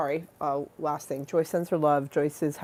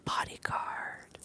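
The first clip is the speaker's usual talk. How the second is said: slow whisper; 8 dB quieter, much slower than usual. Real, not acted.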